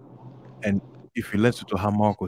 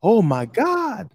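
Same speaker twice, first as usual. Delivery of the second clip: raised, slow "Oh my God" with a big lift in pitch; rising exclamation; 12 semitones higher, 4 dB louder, much slower than usual. Shown as a demonstration. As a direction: lift your tone high on "Oh my God". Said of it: "you raise your tone".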